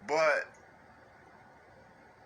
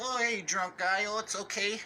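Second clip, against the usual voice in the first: mock nice; 4 semitones higher than usual. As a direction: coo it like soothing a drunk. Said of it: "if you was to be nice to the drunk person".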